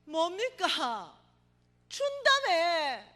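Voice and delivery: high, loud, slow sobbing outcry; lying on the beach crying out to God; wailing cry. Retold or imitated. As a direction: howl it at heaven, heartbroken. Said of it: "이러면서 울고불고 난리가 난 거예요".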